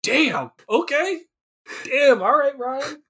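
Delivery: high-pitched, drawn-out comic disbelief; playfully exaggerated surprise; mock startled. Acting out reaction to a crash.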